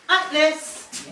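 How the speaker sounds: loud, high call of Atlas; calling the dog. Real, not acted. Trainer calling the puppy by name in a loud high call. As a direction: ring out the name to call him in. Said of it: "I'm gonna actually use his name".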